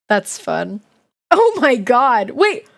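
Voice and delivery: ordinary delivery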